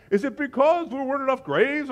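mock-whiny, high sarcastic question; biting mock disbelief. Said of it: "the sarcasm right there".

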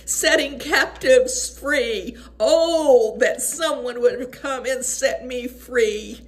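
real tearful, welling-up preaching; overwhelmed with emotion. Real, not acted. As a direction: speak through rising tears about being set free. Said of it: "I just get overwhelmed".

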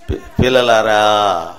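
low, slow fatherly call to disciples; Jesus. Retold or imitated. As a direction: draw it out, deep and tender. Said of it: "శిష్యులు ఏమని పిలిచేవాడు?". (లోతుగా, నెమ్మదిగా పిలుపు)

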